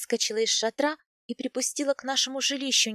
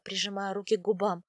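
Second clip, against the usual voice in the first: plain reading voice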